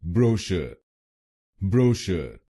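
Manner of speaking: ब्रोशर said as BRO-shuh, no final r; ब्रोशर with British vowels, r dropped; British pronunciation. Shown as a demonstration. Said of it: "British English में इसको बोलेंगे". (British उच्चारण में ब्रोशर)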